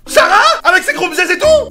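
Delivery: high shout of surprise; shocked. Real, not acted. Gamer hearing her friend's name, in loud shocked disbelief. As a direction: burst out in disbelief.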